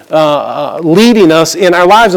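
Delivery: ordinary talk, nothing marked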